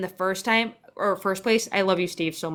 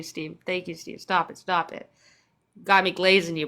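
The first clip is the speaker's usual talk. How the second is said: normal talking voice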